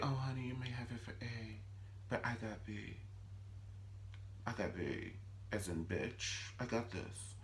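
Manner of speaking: very soft, smug villainess; hushed menace. Retold or imitated. Wife answering husband's restraining order, hushed menace. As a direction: answer quietly, sure of the upper hand.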